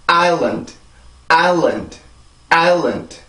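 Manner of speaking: island as EYE-lund, big beat then small beat; English two-syllable rhythm. Shown as a demonstration. Teacher modelling for repetition, island as two-beat EYE-lund. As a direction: stress island's first vowel, end on a bare D. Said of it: "이렇게 해서 이 단어가 발음이 돼요".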